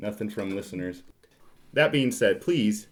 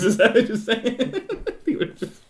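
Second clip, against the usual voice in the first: laughing through the words, higher; 13 semitones higher, 8 dB louder than usual. Real, not acted. Cohost laughing at the joke about passive listeners, real laughter. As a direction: crack up mid-question.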